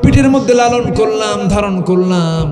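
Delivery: mother's plea climbing high and loud; impassioned preaching plea. Retold or imitated. Preacher voicing a mother pleading for her son, impassioned preaching. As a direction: cry out each phrase to the congregation.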